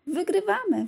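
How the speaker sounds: Anna cheering as the sled takes the lead; excited shout. A narrator's reading. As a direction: call out, thrilled to be winning. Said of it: "wołała podekscytowana Anna".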